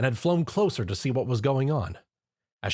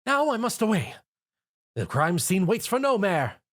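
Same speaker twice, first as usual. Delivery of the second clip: high, grand exit line; dramatic declaration; 10 semitones higher than usual. A narrator's reading. Rarity announces her exit to the group, high and dramatic. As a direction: sweep out of the room declaiming. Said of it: "Rarity dramatically declared".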